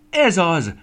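high, loud cry of realisation; exclaiming. A narrator's reading. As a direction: cry out at a sudden idea. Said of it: "kiáltott fel". (hangos, magas felkiáltás)

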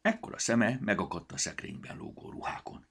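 ordinary delivery

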